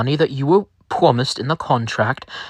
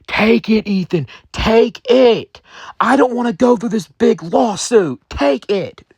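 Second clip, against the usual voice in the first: high, urgent pleading in a lightly voiced partner; 10 semitones higher than usual. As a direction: beg him to take the money. Partner begs him to accept.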